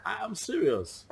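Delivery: high, drawn-out insistence; earnest protest. Acted. Protests that he is not joking.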